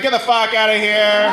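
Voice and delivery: slow, scornful brush-off; dismissive scoff. Retold or imitated. Comic scoffing at the scam caller, slow and scornful. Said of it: "I'm like".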